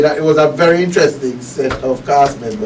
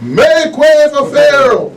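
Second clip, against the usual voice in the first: slow, high herald's announcement; booming pharaoh; 10 semitones higher, 4 dB louder, slower than usual. Retold or imitated. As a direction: proclaim the entrance grandly.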